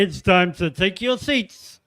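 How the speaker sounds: slow booming bingo caller call. Acted. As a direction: drag it out like calling numbers. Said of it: "that was a bit like bingo caller".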